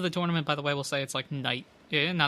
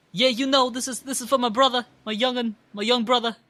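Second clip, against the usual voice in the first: higher, louder character voice; proud Piplup brother; 8 semitones higher, 8 dB louder than usual. Acted. Prinplup dedicating the win to a brother, proud high character voice. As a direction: play the proud brother, pitched up and punchy. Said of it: "from Boston with an Italian accent".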